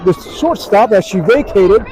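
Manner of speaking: high, loud live call; excited play-by-play. Real, not acted. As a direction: call the ball getting through as it happens.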